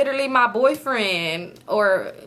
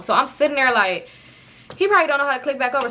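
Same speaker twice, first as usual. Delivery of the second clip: ordinary talk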